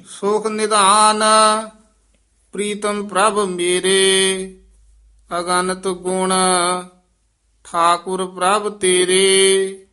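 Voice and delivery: measured, half-chanted verse reading; chanted Gurbani reading; scripture recitation. Acted. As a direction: recite sacred verse with long held vowels.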